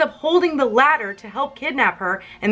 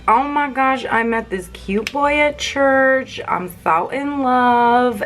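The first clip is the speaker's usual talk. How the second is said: high, drawn-out lovestruck gushing; hammed-up swooning over a crush; Gilda; 5 semitones higher, slower than usual. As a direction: gush slowly like a smitten girl. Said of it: "Gilda's like".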